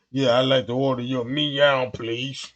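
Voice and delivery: low, lightly disguised customer voice. Retold or imitated. Customer in wig and goggles ordering, low disguised voice. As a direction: hide your voice down low.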